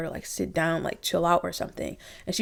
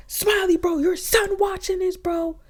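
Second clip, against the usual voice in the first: imitating Tasiki; 12 semitones higher than usual. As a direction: shout at her to pull it together, pitched way up. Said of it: "So then here come Tasiki".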